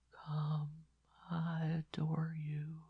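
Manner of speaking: put-on dying boy begging the nun, whispered; faint far-away whisper. A narrator's reading. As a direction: beg her in a dying whisper. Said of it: "his voice was now quiet, far, far away".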